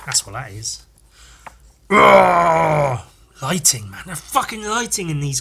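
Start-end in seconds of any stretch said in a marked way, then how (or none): 1.9-3.0 long, loud groan of disgust. Real, not acted. Reader reacting to a twin eaten in utero, loud groan. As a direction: let out a big drawn-out ugh. Reacting to the gruesome comic panel.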